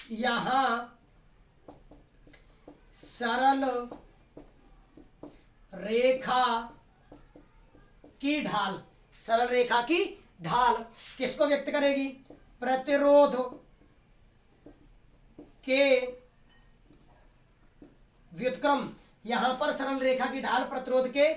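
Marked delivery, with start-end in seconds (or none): 0.1-8.8 word by word with long gaps; slow, spaced dictation. Shown as a demonstration. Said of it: "teacher dictating word by word". 12.6-18.9 प्रतिरोध loud, then के and व्युत्क्रम after long pauses; emphatic dictation. Shown as a demonstration. Teacher dictating the key answer.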